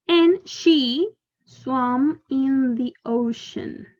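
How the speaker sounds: slow model reading. Shown as a demonstration. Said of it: "teacher models the sentence slowly".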